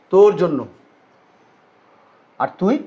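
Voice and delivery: ordinary talk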